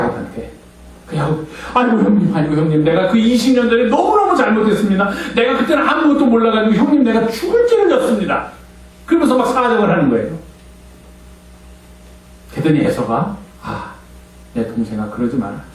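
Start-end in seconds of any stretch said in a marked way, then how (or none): 1.7-8.5 high, pleading voice with a laugh in it; higher, begging younger brother; pleading Jacob. Acted. Jacob begs Esau for forgiveness. 13.6-15.6 put-on lower, softer, calm older brother; soft, reassuring Esau; gentle older brother. Esau gently answers his brother.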